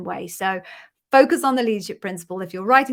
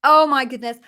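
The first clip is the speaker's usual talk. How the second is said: louder, delighted exclamation; excited gasp; 5 semitones higher, 7 dB louder than usual. Real, not acted. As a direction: react to happy news. Overjoyed at candidate's offer.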